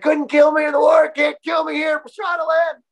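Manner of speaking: high, shouted tough-guy bravado; quoted defiant shout. Retold or imitated. Injured vet shouting off his crash at the stunt ramp, defiant and high. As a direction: yell it like an invincible soldier laughing off a crash. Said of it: "He's like".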